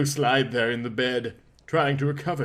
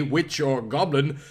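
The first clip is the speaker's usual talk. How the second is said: plain reading voice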